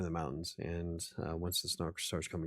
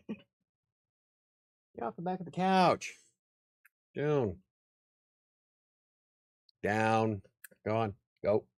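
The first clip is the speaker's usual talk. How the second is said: scolding the dog; 3 semitones higher than usual. Real, not acted. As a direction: order the dog down sharply. Shooing dog off the couch.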